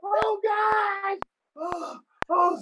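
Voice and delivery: very high, drawn-out mock wails; overwhelmed wailing. Fan hears idol sing his song, hammed-up wailing. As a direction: howl it like a fan about to collapse.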